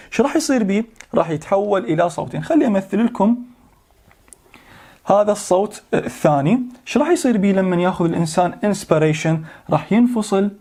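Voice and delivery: ordinary delivery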